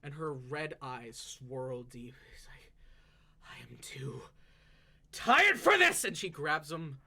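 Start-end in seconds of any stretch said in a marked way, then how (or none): 3.4-4.2 whispered, worn-out start; exhausted whisper. Acted. Exhausted elf to the robot, whispered. 5.1-6.0 loud, high burst of frustration; exasperated outburst. Acted. Exhausted elf at the unmoving robot, loud exasperation.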